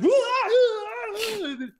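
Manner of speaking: high, loud mock waking-up wail; startled yelp. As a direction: imitate someone jolted awake with wailing gasps. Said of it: "He's like".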